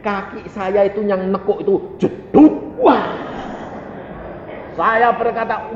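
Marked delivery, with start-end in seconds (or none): none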